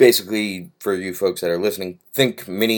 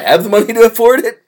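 high loud mock brag; mock boast; 10 dB louder than usual. Real, not acted. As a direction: pitch it up as a joke.

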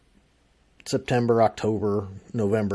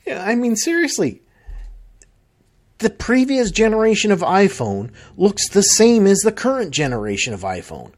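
exasperated rant; 8 semitones higher, 3 dB louder than usual. Real, not acted. Complaining rant about identical phones.

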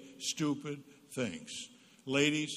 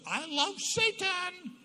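very high, slow, hammed-up; mock shocking teen; 3 dB louder, much slower than usual. Son as mother imagined it, hammed-up. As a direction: make it sound scandalous. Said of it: "You would have thought the kid said".

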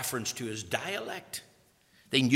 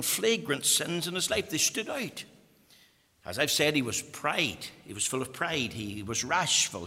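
ordinary delivery

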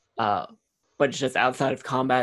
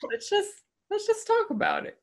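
very high, silly squeak; squeaky high voice. Pretend. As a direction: squeak it out in a cartoon voice. Silly repeat of catchphrase.

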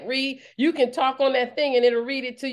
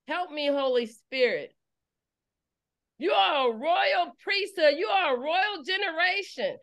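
fervent preaching at full force; 5 semitones higher than usual. Acted. Preacher rousing her congregation, fervent preaching. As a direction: drive the message home, rising and urgent.

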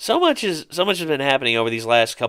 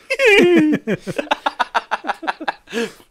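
very high stuttering cartoon cackle; SpongeBob laugh impression; 4 dB louder than usual. Fan imitating SpongeBob, high stuttering cartoon laugh. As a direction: rattle out a high, pulsing giggle.

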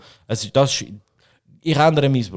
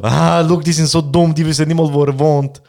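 louder, higher smug taunt; mocking; 5 semitones higher, 6 dB louder than usual. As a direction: gloat at the clueless police. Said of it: "Und wir so".

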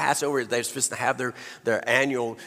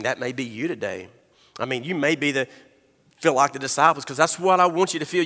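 ordinary delivery

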